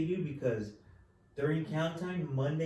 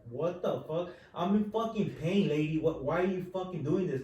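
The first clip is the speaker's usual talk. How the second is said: loud, higher-pitched retold outburst; angry, raised-voice protest, acted out; flipping out; 4 semitones higher, 3 dB louder than usual. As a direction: re-enact losing it at the nurse. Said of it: "I flipped out".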